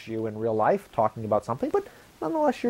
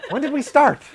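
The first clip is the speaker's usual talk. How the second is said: confused surprise; 11 semitones higher, 4 dB louder than usual. Retold or imitated. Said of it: "and I could go".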